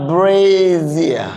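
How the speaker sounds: "brazier" drawn out slowly, BRAAAY-zee-uh; correct pronunciation. Shown as a demonstration. Teacher models it for everybody to repeat, slow and drawn-out. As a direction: stretch "brazier" syllable by syllable with a buzzed z.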